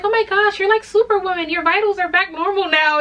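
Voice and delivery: high, excited nurse voice; the nurse gushing in surprise; amazed nurse. Retold or imitated. Nurse telling the new mom her vitals are back, amazed.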